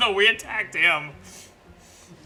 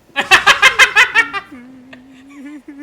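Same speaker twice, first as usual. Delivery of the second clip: loud high laugh; 9 semitones higher, 8 dB louder than usual. Real, not acted. Laughing at character whimpering.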